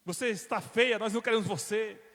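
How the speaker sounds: high, scornful brush-off; mocking rejection. Men scornfully pushing the woman away, contemptuous. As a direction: sneer her away.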